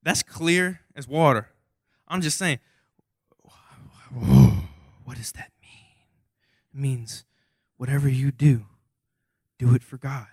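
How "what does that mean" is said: very low, slow, booming mock question; mock deep voice. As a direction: drop way down and drag it out like a grave teacher.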